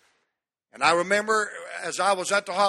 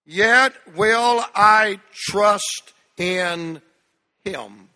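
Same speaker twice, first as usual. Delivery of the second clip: louder and very slow, leading the room; loud congregational reading; 7 dB louder, much slower than usual. Acted. Pastor leading congregation in reading, loud and slow. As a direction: lead the church through each word. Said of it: "read it out loud with me, church".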